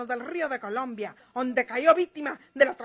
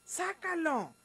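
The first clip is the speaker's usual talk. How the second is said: much softer, low resigned voice; quiet grudging consent; 6 semitones lower, 9 dB quieter than usual. Acted. María Eugenia grudgingly tells Gumaro where it is, quietly. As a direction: give in under your breath.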